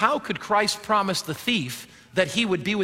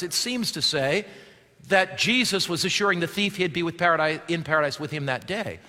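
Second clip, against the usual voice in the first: usual speaking voice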